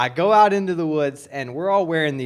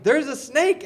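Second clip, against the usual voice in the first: alarmed warning; 10 semitones higher than usual. Retold or imitated. Rider warns friends of a snake.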